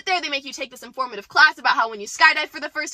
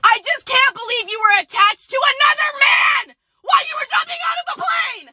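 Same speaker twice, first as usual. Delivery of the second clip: jealous shout; 8 semitones higher than usual. Retold or imitated. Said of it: "And he's like".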